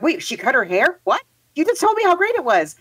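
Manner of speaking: mock disbelief, pitch leaping up at the end; startled reader. Reader reacting to the sudden haircut in the story, startled. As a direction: react like a reader caught off guard.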